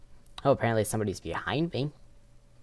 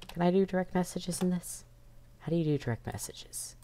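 whispering; 9 semitones higher, 4 dB quieter than usual. Real, not acted. Whispered aside while hiding.

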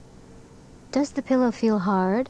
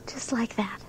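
soft whispered aside; whispering; 2 semitones higher, 9 dB quieter than usual. Acted. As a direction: breathe the words. After the yawn demo.

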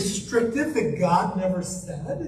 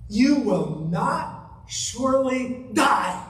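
slow serpent lie; much slower than usual. Acted. Serpent's lie read slowly.

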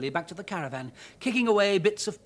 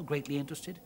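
ordinary delivery

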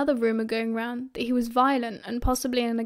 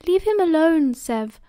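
higher, louder scolding that drops on the name; chiding; 5 semitones higher, 5 dB louder than usual. A narrator's reading. Lily defends Remus from Severus, chiding. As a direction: tell a friend off in his defence. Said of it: "Lily chided".

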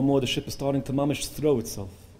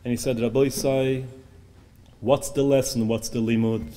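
ordinary talk

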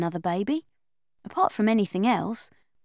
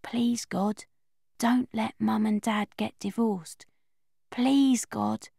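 hushed whisper under the duvet; slow whispered bedtime plea; whispered prayer; 7 dB quieter, slower than usual. Acted. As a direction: whisper it so nobody hears. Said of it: "going to sleep without saying my prayer".

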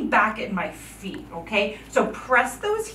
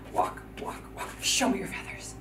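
whisper; 4 semitones lower, 12 dB quieter than usual. Shown as a demonstration. Kids marching quietly as peacocks.

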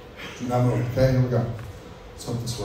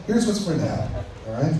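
ordinary delivery

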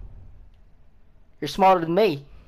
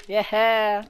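ordinary talk, nothing marked

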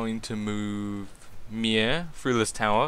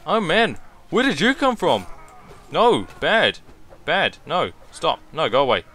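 panicked; 5 semitones higher, 4 dB louder than usual. Real, not acted. Enemy appears, shooing it off.

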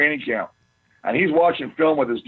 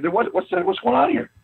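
mock surprise; 8 semitones higher than usual. Players puzzled by shopping carts.

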